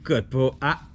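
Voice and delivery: normal talking voice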